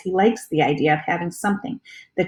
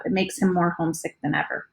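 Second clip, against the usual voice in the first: ordinary delivery, nothing marked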